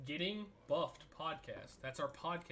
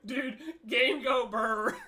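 silly dumb voice; 8 semitones higher, 8 dB louder, slower than usual. Pretend. Silly caveman phrasing.

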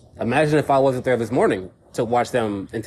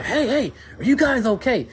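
retold yell; 13 semitones higher than usual. Calling out to a fighting couple from his seat outside, high mock yell. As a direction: call out high across the street at the fighting couple. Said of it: "I kinda scream and yell".

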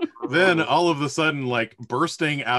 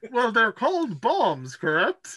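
Brewer's voice; 9 semitones higher than usual. Acted. GM holds Brewer voice.

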